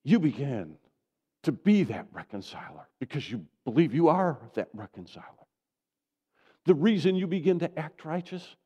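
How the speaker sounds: loud, rising preacher's build; fervent preaching. Real, not acted. Pastor pressing the church to believe, fervent.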